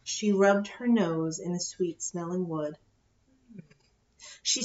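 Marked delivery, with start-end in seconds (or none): none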